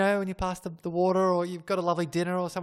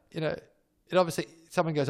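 ordinary talk

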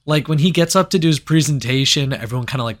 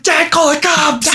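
mock shout; 13 semitones higher than usual. Shouting to dad from outside.

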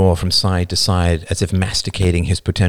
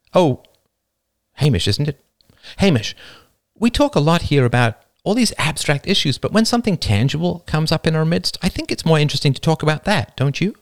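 higher-pitched, eager Jane; relentlessly positive; 9 semitones higher than usual. A narrator's reading. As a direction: coax brightly with earnest interest. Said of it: "Her positive attitude was annoyingly contagious".

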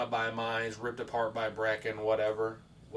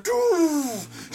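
loud drawn-out mouth-made blast; imitated gunshot; 13 semitones higher, 9 dB louder than usual. Friend turns and fires the shotgun, loud imitated blast. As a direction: boom it out like a shotgun.

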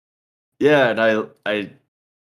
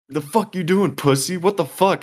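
high-pitched mock yell at the attacker; retold angry yell; 6 semitones higher than usual. Confronting the man grabbing the lady, high angry yell. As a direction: bark it at him, pitched up.

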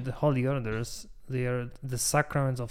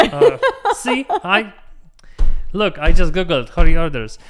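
loud laughing through the words; 4 semitones higher, 14 dB louder than usual. Real, not acted. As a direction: laugh while getting the words out. Laughing loudly after the joke.

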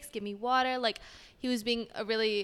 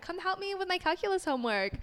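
high, sing-song retold reply; playful high-voiced acceptance; 8 semitones higher than usual. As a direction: act out saying yes in a much higher voice. Re-enacts accepting his offer of help.

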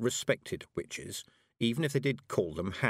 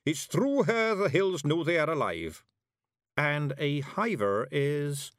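high Scottish voice slowing into hesitation; earnest Scots warrior; 7 semitones higher, 4 dB louder, slower than usual. A narrator's reading. Warrior explains the hills' hag to witch, earnest. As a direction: explain solemnly, then falter.

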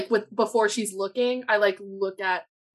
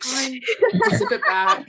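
laughing; 12 semitones higher, 4 dB louder than usual. Real, not acted.

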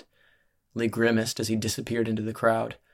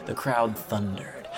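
usual speaking voice

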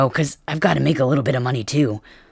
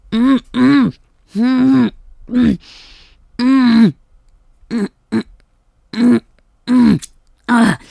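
rising high-pitched moans, louder; high moaning; 8 semitones higher, 4 dB louder, slower than usual. Acted. Moaning high and loud with a partner at climax. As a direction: let out short climbing moans up high.

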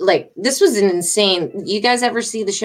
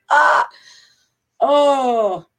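upset; 6 semitones higher, 3 dB louder than usual. Real, not acted. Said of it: "my heart's going right now".

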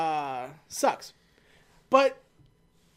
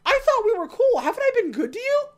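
much higher whining protest; whiny hurt plea; 6 dB louder than usual. Retold or imitated. Riza whines at her traitor, pitched high. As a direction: plead like a wounded friend. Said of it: "And riza's like".

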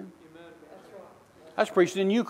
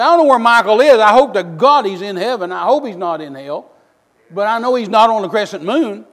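louder, fervent shouting retort; retold yell; 2 semitones higher, 10 dB louder than usual. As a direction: shout it back at the TV screen. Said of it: "And I'm yelling at her".

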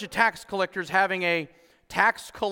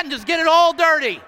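loud, high preacher's shout; full-force preaching; 11 semitones higher, 10 dB louder than usual. Real, not acted. Pastor to his church, shouting the point. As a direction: bellow it to the church.

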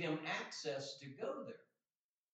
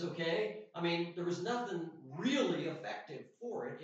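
ordinary talk

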